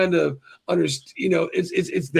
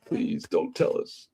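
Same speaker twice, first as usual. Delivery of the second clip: whispered plea; 5 semitones lower, 7 dB quieter, slower than usual. Real, not acted. Pleading fans not to spoil.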